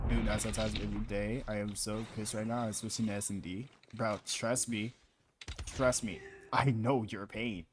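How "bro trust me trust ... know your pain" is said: slow consoling. Real, not acted. Quiet slow echo of earlier consolation.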